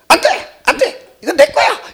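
put-on high, loud childish shout of no no, mine. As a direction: yell it like a greedy little kid grabbing his toy. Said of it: "얘가 또 뭐라는지 알아요?".

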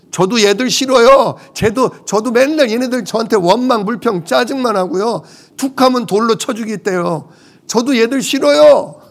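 high-pitched acted grumbling; exasperated complaint. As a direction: complain to God like a fed-up leader. Voices Moses complaining to God.

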